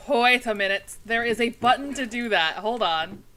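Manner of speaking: loud, emphatic dawning realization; sudden realization. Retold or imitated. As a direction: exclaim it as if it just hit you. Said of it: "realization. I was like".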